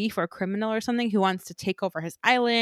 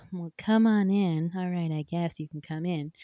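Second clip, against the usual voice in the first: grudging resignation; 2 semitones lower than usual. Acted. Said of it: "she's like".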